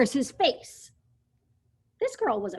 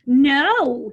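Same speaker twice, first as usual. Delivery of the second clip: firm no; 5 semitones higher, 11 dB louder than usual. Acted. Dinosaur refuses, loud.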